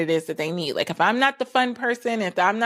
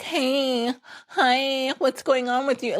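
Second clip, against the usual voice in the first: higher, sugary put-on friendliness; suddenly nice; 6 semitones higher, slower than usual. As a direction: sweeten up to get something. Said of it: "all of a sudden you get really nice".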